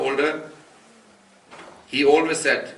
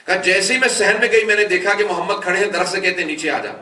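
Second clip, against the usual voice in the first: loud, rising sermon delivery; impassioned majlis narration; full-force preaching; 6 semitones higher, 5 dB louder than usual. Acted. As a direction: preach it out to the crowd, building. Preacher tells Fatima bint Asad's story.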